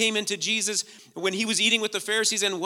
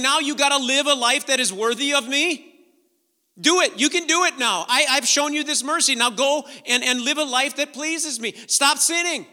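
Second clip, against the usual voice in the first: mock demanding taskmaster; 6 semitones higher, 7 dB louder than usual. Mock demanding Jesus retold.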